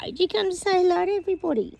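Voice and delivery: high, quick voice for a pet; talking to a dog. Real, not acted. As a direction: coo at the dog, high and fast.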